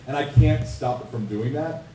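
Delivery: ordinary talk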